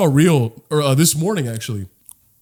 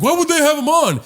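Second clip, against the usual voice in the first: mock bigot; 11 semitones higher, 3 dB louder than usual. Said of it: "it's just like".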